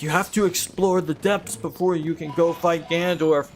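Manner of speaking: high, nagging mock-upset voice; McMewtwo. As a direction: scold like an exasperated rule-keeper. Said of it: "He'd be like".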